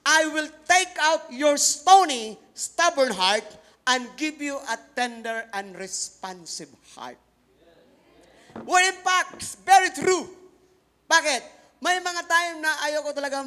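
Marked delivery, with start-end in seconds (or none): 0.0-7.2 solemn scripture proclamation; scripture reading. Acted. 8.6-10.3 much higher and louder exclamation; loud, high exclaiming preacher; preaching shout. Real, not acted.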